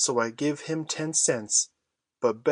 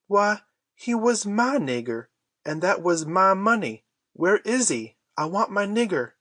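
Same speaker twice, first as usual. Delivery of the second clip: higher, pleading mock tears; a touch of a boy's tearful wail, voice pitched up; crying; 7 semitones higher than usual. Huck to the Duke, demanding his Jim back, tearful and higher. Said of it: "and begun to cry".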